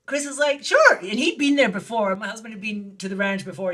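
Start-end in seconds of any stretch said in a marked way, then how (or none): 0.6-1.0 very high, loud quick yes; retold eager agreement. Husband accepting a surprise ranch invitation, high and eager. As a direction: pitch leaps in instant acceptance.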